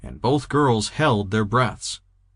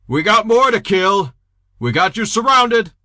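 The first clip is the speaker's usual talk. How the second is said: threatening shout; 11 semitones higher, 6 dB louder than usual. Acted.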